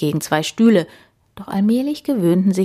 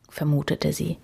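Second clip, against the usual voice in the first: usual speaking voice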